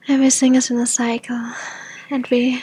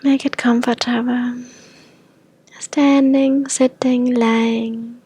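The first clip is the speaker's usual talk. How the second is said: unhurried meditation voice with long pauses; guided meditation; 3 dB quieter than usual. Acted. Teacher leading listeners to invite hope into the body, guided meditation. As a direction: guide them gently inward, phrase by phrase.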